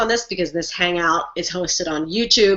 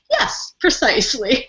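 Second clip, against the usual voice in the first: smug reply; 6 semitones higher, slower than usual. Retold or imitated. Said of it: "And I wrote back".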